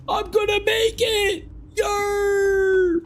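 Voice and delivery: very high strained cry; triumphant yell. Real, not acted. Streamer just making it in a game, yelling.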